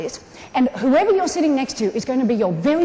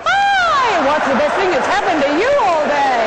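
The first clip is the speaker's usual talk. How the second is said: very high, loud, long "Hi" and excited question; energy and vitality; 4 semitones higher, 5 dB louder than usual. Shown as a demonstration. Leading the audience on the count of three, exuberant. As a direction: squeal the greeting out to the whole room. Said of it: "with real energy and vitality".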